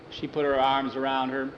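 ordinary delivery, nothing marked

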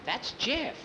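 put-on high, hushed boy's voice; young kid whispering the name. A narrator's reading. Boy whispering recognition.

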